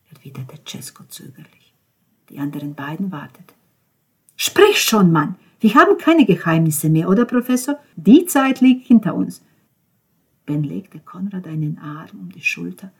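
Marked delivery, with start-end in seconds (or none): 4.4-9.4 high, loud push; urging. Acted. Ben urging Cesco to talk, high and loud. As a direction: press him to speak.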